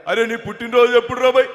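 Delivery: loud mimicry of a familiar elder politician; V Hanumantha Rao. Politician asking the comedian his birthday, loud mimicked voice. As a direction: ask it loudly as the mimicked politician. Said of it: "Hanumantharao గారి bit ఒకటి".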